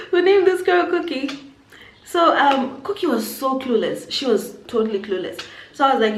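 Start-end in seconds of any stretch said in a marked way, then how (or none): 0.1-1.3 high, laughing disbelief. Real, not acted. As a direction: laugh out the question, amazed. Incredulous laugh at the name.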